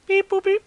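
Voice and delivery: high-pitched electronic beeps; beep-boop noises. Retold or imitated. Prank caller testing his voice gadget, imitated high beeps.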